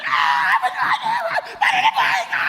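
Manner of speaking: loud, high, half-laughing cry of despair; mock hopeless wail. Preacher mocking a mourner with no hope, loud wailing. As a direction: ham up a mourner howling with no hope. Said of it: "Nakakita na kayo namatay na walang pag-asang dalamhati?".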